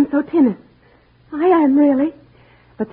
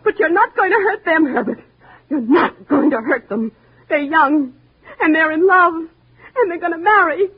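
tearful stand; 7 semitones higher than usual. Acted. Wife defends young couple.

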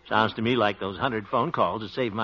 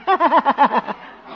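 nervous laugh; 13 semitones higher, 4 dB louder than usual. Acted. After colorblind excuse.

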